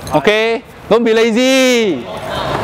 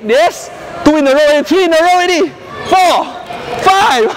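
excited counting; 6 semitones higher than usual. Real, not acted. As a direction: cheer each hit as the count climbs. Coach cheering as rally count grows.

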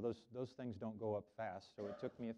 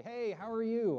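bright greeting pitched well up and louder; "Hey, how are you?" sung out high and friendly; cheery greeting; 11 semitones higher, 7 dB louder, slower than usual. Shown as a demonstration. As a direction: greet a friend warmly across the room. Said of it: "if I was gonna say".

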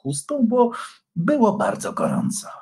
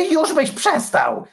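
high, irritated snarl; snapping; 14 semitones higher, 3 dB louder than usual. A narrator's reading. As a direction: cut him off, annoyed. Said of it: "warknął Antoni".

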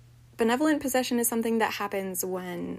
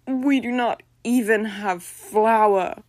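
slow, louder lament; miserable; 8 dB louder, slower than usual. A narrator's reading. Eumenia finding no flour, miserable lament. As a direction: wail it over the empty cupboard. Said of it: "she said miserably".

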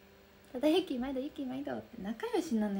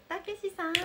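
calling out; 8 semitones higher than usual. Real, not acted. Calls out to a viewer.

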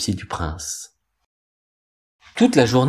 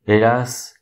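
ordinary delivery, nothing marked

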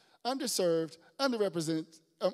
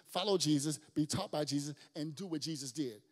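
ordinary talk, nothing marked

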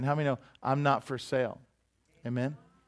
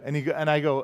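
usual speaking voice

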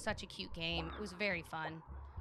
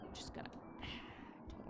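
breathy whispered aside with a sigh; 11 dB quieter, faster than usual. Real, not acted. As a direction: mutter under your breath, then sigh. Quiet aside while playing.

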